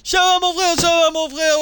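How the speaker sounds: high, loud brotherly hailing; hyped greeting. Real, not acted. Streamer hailing a viewer as his brother, high and hyped.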